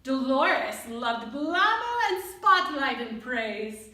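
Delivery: ordinary delivery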